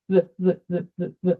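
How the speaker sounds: bare "v" repeated, lips nearly touching; short repeated "v" pulses, lips not quite closed; lips barely together. Shown as a demonstration. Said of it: "putting the lips together without really".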